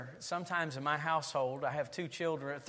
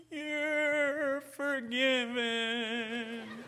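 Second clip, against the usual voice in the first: very slow, higher, gentle voice; tender forgiveness; 6 semitones higher, much slower than usual. Retold or imitated. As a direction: daughter forgiving her brother through the snubs. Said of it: "when she looks at my son and says".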